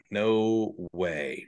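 ordinary delivery

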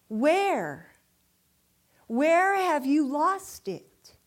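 loud, drawn-out questioning; acted demanding question. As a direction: call the question out slowly, as the prophet. Acting Elisha's question to the prophets.

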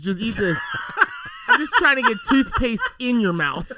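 laughing tease. Real, not acted. Teasing friend about toothpaste candy.

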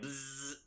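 soft, quiet buzzing hum; buzzing bee sound. Shown as a demonstration. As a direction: buzz like a bee, no word. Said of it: "bzz isn't a word".

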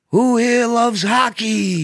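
high, loud, slow pandering shout; hack crowd work. Retold or imitated. Comic quoting Dice's cheesy hockey line. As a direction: stretch it out to the crowd. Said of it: "then go".

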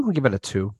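normal talking voice

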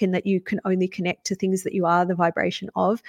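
ordinary delivery

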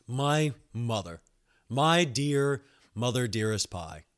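ordinary talk, nothing marked

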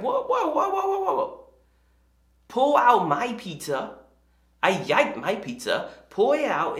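high, laughable mock-kid alarm; protesting little girl. Younger daughter guarding her pizza, mock indignant protest. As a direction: imitate a small child objecting in baby talk.

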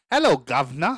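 loud, hammy British greeting; mock British accent. Shown as a demonstration. Shout-out to Max, loud mock British accent. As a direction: belt out a cartoon British hello. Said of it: "this is my British".

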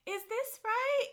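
high, slow, unsure little voice; high timid client voice. Retold or imitated. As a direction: ask it meekly, up high. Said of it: "She goes".

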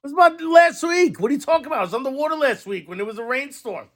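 high-pitched, disbelieving complaint; exasperated rant. Retold or imitated. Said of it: "But I'm like".

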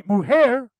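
"mujer" said the Spanish way, muh-HER with a breathy h. Shown as a demonstration. Explaining Spanish words to viewers, careful Spanish pronunciation. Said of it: "mujer in Castilian Spanish means woman".

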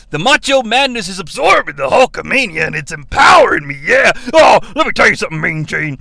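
over-the-top wrestler cutting a promo; macho wrestler promo. Retold or imitated. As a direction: bellow it like a wrestling interview. Wrestler promo interview impression.

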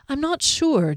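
doubtful reply, starting high; sighing. A narrator's reading. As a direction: admit doubt with a sigh. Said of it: "Derry replied with a sigh".

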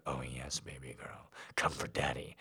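low, soft, half-whispered; hushed growl. A narrator's reading. Daddy urging his baby girl, low and hushed. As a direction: breathe it low and close. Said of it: "Daddy growled".

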